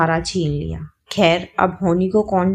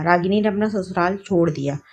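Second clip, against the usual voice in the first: plain reading voice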